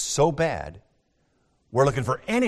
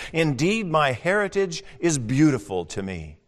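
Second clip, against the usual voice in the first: slow, solemn psalm reading, louder at first; scripture reading; 5 dB louder than usual. Acted. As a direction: read the psalm slowly, like a solemn text from the pulpit. Reads a psalm aloud.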